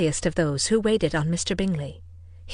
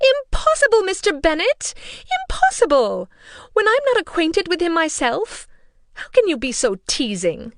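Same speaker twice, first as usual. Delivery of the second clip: much higher, louder protesting mother; exasperated protest; 10 semitones higher, 6 dB louder than usual. A narrator's reading. Mother protesting to Mr Bennet, high and loud. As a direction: throw up her hands at him.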